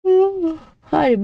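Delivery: high yawning ooh then apology. Real, not acted. Narrator yawns mid-reading, high ooh. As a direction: let a real yawn slip. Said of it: "I'm yawning again".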